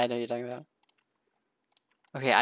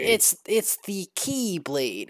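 slow, higher-pitched explainer voice; cutscene character; 6 semitones higher, much slower than usual. Retold or imitated. Podcaster acting out a cutscene for friends, slow mock explainer. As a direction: act out a character slowly explaining himself. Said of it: "He's like".

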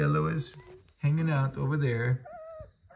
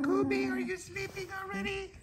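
high soft cooing to a sleepy pup; 8 dB quieter than usual. Real, not acted. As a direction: coo gently, way up high. Soft high baby talk to dog.

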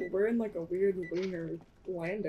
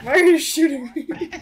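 loud; 8 semitones higher, 15 dB louder than usual. Real, not acted. Mock outrage at being shot.

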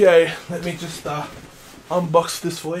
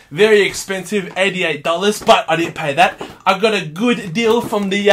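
usual speaking voice

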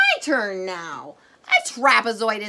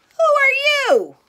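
high surprised question; 14 semitones higher, 6 dB louder than usual. Acted. Director character greets newcomer.

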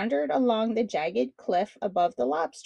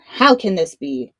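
louder, sharp outrage; tricked shark king snapping in anger; angry snap; 4 semitones lower, 8 dB louder than usual. Acted. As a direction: snap it in fury. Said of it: "snapped in anger".

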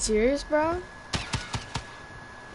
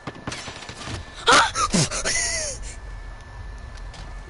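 dramatic gasp. Real, not acted. Shocked reaction to being shot.

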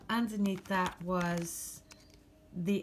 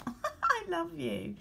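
laughing; 11 semitones higher, much slower than usual. Real, not acted. Laughs over love line.

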